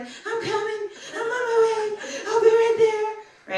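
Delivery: high, loud, eager shout; excited dog voice. Retold or imitated. The dog answers his gangbanger friend, high excited shout. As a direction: call out breathlessly as you run to him, final words slowed.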